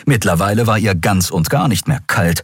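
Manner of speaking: ordinary delivery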